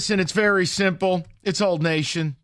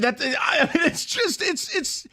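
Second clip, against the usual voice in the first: exasperated; 12 semitones higher, slower than usual. Real, not acted. Host venting over holdout.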